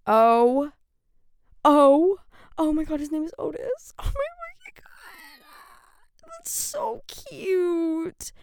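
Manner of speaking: cracking high shriek into tears; tearful squeal. Real, not acted. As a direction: let the joy break into crying. Emotional over baby's name.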